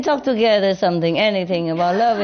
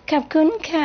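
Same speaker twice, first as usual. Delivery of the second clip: higher, lilting Thai thank-you; sweet Thai thanks; 6 semitones higher than usual. Shown as a demonstration. Master imitating how Thai girls say thanks sweetly. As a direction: sing it sweetly like a Thai girl. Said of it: "they speak so sweetly".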